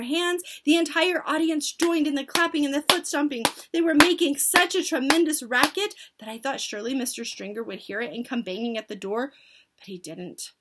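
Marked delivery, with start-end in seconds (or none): none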